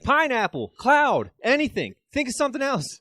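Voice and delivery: loud, high, hurried pleading; desperate dad steering a toddler; frantic redirecting. Retold or imitated. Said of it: "He's like".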